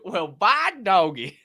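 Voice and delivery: slow, high Southern drawl; imitated southern accent. Host mimicking the woman's accent, exaggerated southern drawl. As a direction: draw it out in a mock Southern twang. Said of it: "Her southern accent too".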